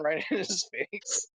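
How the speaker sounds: normal talking voice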